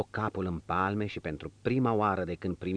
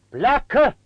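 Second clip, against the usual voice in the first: 1 furious dismissal; 11 dB louder than usual. A narrator's reading. Master throws out servant.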